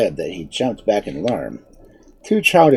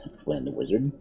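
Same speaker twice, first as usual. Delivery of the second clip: ordinary delivery, nothing marked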